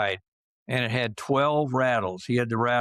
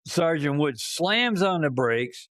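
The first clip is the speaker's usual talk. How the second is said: usual speaking voice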